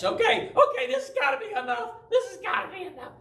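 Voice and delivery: put-on high, fast, squeaky-kid pleading; mock panicked child. Scared boy begs dad to let him stop, mock panic. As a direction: plead rapidly, climbing in pitch, played for laughs.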